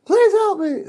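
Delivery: very high, whimpering little cries of a trapped dog; pleading dog voice. Retold or imitated. Trapped dog in the foundation begging for help, high whimpers. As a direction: squeak it out like a stuck puppy begging. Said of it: "And it was going".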